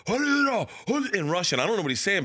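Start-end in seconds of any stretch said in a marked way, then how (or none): 0.1-1.1 mock gangster yelling at the cop. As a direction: shout it out in joy. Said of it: "starts yelling".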